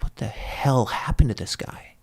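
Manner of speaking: whispered, slow and hushed; hushed slow retold nurse question. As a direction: murmur it like nurses talking over a bed. Nurses wondering over the frozen recruit.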